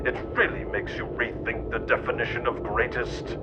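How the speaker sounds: usual speaking voice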